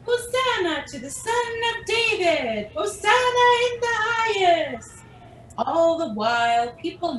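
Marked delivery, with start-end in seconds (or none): 0.0-5.0 high, ringing hosanna cry; singing out praise; joyful shouting. Acted. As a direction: call it out like a celebrating crowd. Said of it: "celebrating and shouting".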